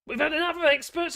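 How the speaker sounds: high, loud mocking politician voice; mocking politician quote. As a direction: parrot the slogan in a pompous, mocking voice. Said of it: "Was it Michael Gove?".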